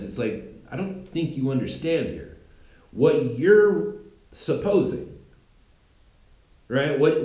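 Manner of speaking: normal talking voice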